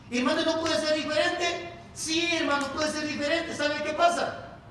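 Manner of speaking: rising full-force preaching. Real, not acted. Preacher telling brothers things can be different, building full-force preaching.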